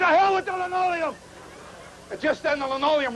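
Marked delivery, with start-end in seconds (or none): 0.0-1.1 mock-enraged outburst, much higher; fit of rage. Father ripping up the zinc strip, hammed-up angry yell. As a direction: play the old man at the end of his rope, hammed up. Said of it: "in a fantastic fit of rage".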